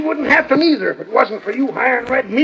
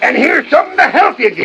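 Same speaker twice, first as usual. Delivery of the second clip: loud, angry shout as he drives him off; 4 semitones higher, 3 dB louder than usual. Acted. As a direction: bark it out to chase the man away. Drives the rival off, firing.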